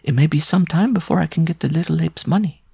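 high-pitched plotting bride; high voice of a conniving sweetheart; scheming bride. A narrator's reading. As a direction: confide the scheme in a high, put-on female voice. Bride plotting with her lover.

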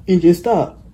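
ordinary delivery

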